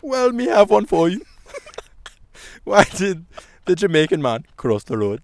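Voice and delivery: loud, hammed-up Caribbean accent. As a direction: tell the joke in a bad Caribbean accent. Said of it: "in a Caribbean accent".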